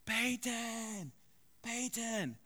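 high, drawn-out calling voice; calling the dog. Retold or imitated. Owner at the door calling his dog in, high drawn-out call. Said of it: "two yells".

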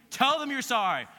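a stern mother insisting the second time; insistent mom. Retold or imitated. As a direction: mom pressing harder. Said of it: "your mom says what".

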